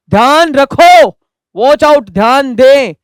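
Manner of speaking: loud, high warning call; warning shout. Acted. Teacher shouts a sudden warning to students, loud and high.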